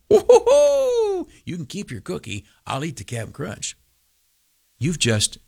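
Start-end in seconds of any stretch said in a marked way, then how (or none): 0.1-1.3 high falsetto woo-hoo of delight; gleeful whoop. Pretend. Pastor whoops at the Cap'n Crunch trade, high and gleeful. 1.4-3.8 quiet gloat. Pretend. Gloating after the whoop.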